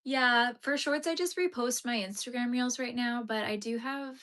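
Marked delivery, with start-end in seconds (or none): none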